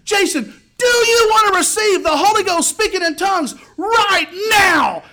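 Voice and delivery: very high, full-force fervent shout. Retold or imitated. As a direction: roar the invitation at peak excitement. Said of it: "he got even more excited".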